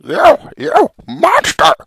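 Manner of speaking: high, slow outraged cry. Acted. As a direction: cry out in shock. Frog confronts villain.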